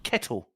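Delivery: "Kettle." with a clear "e" and a sharp sounded T; careful British "Kettle."; crisp T in kettle. Shown as a demonstration. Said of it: "I sounded the T".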